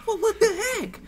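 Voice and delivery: high-pitched acted disbelief; mock bafflement. Employee retelling his reaction to the letter, mock bafflement. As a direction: jump up high in comic surprise. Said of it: "And so I'm like".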